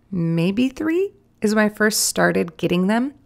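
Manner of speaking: ordinary delivery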